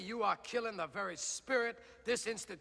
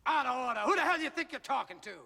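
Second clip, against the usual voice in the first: high, loud, rushed indignation; angry, rapid-fire challenge; outraged retort; 4 semitones higher, 4 dB louder, much faster than usual. Acted. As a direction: fire it back furiously.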